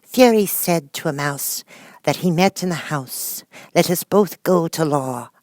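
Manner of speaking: high, loud, slow storytelling chant; recited rhyme. Acted. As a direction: recite the tale with rhythm. Tale recited as verse.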